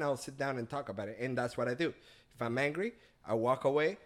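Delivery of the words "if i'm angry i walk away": ordinary talk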